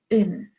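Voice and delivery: 「un」 an oo vowel closed with N; pronounced un. Shown as a demonstration. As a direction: say 「un」 as oo then n. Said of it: "you pronounce this as".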